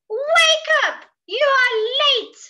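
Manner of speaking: high, loud hungry-animal shout; loud, high-pitched animal clamour; crying out. Acted. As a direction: holler to rouse a sleeper, drawing it out. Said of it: "cried the hungry animals".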